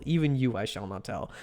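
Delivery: hammed-up dramatic whisper-low refusal; a lightly voiced stage vow, dropping low and soft; mock solemn vow.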